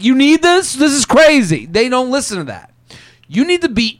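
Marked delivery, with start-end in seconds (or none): 0.0-1.7 incredulous outsider. Retold or imitated. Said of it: "you're like".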